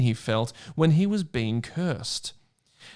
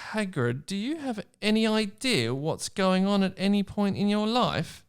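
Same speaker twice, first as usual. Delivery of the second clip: Sam; 7 semitones higher than usual. Acted. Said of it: "said Sam".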